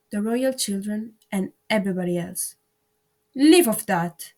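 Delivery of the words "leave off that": loud, slow scream. A narrator's reading. Queen screams at the bowing gardeners, loud scream. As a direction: scream it out slowly. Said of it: "screamed the queen".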